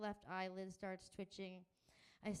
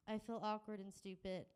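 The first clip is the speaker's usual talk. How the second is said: ordinary delivery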